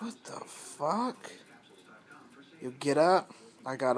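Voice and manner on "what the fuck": slow, drawn-out stunned disbelief. Real, not acted. Hearing strange talking in the empty house, slow stunned disbelief. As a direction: let it hang, slow and baffled.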